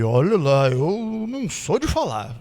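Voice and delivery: shy man. Retold or imitated. Shy miner warning the pastor: slow low reluctant voice. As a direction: drag the words out reluctantly. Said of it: "tímido".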